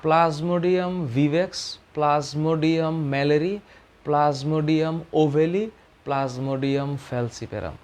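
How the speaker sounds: very slow, each Latin name spaced out; teacher reading a list slowly for memorising; slow dictation. Shown as a demonstration. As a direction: dictate each species name for students to note down. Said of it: "একটু মনে রাখো".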